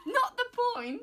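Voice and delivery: high, loud laughing protest; squealed retort. Retold or imitated. Answering mum's lecture on eating and driving, high squealed protest. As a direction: shriek it out in disbelief.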